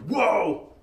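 mock-mad exclamation; mock angry outburst. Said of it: "If you're mad at someone".

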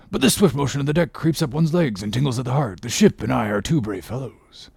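rollicking sailor's voice, rushed with bursts of laughter-like cries; hearty seaman revelling in the speed; exultant Stubb. A narrator's reading. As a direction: crow it like a thrilled whaleman.

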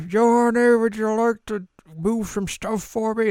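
put-on squeaky caricature of an old villager; hammed-up old man. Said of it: "this old guy's like".

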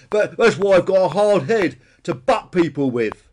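loud, high, self-justifying dinosaur; defiant bonehead Peter. A narrator's reading. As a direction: insist loudly you're entitled to butt. Peter defends headbutting.

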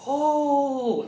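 put-on high childlike gasp of wonder; a high, amazed oh; kids in awe. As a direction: play the awed kids. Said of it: "all the kids are like".

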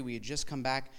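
ordinary talk

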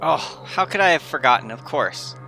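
usual speaking voice

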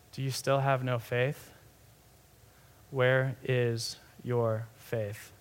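soft, low, slowing to a careful pace; gentle loving rebuke. Retold or imitated. Jesus to someone after calming their storm, gently rebuking. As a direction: chide tenderly, without any force. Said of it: "gentle and loving rebuke".

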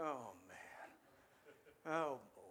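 low, soft, weary eye-roll groan; exasperated sigh. Retold or imitated. Jesus rolling his eyes at his disciples, low and weary. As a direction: sigh at the knuckleheads. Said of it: "Jesus rolled his eyes like".

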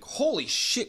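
high-pitched startled exclamation; retold shock. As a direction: imitate her shock at the door. Girl finds escapee at door.

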